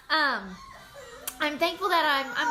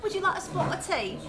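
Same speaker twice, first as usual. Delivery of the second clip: higher-pitched put-on British accent; 4 semitones higher than usual. Shown as a demonstration. Self-confessed bad mimic showing off the one accent she can do, British accent. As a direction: do a halfway-decent British tea-time line. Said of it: "the only thing I can say with an accent".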